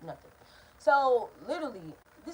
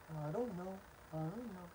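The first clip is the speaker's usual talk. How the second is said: very soft, lower-pitched imitation; small, hushed retold reply; meek denial; 5 semitones lower, 17 dB quieter than usual. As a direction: mumble it like someone playing innocent. Brother's meek denial in retold quarrel.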